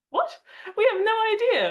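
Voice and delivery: a team pretending it knows nothing, loud mock surprise; mock shocked innocence. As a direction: act wildly surprised, hammed up. Feigning ignorance to accusing country.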